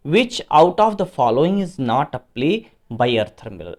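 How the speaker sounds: slow, spaced-out reading of the question; teacher reading the exam question slowly to the class; slow dictation. Acted. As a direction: read each word out as if for dictation. Teacher reads quiz question slowly.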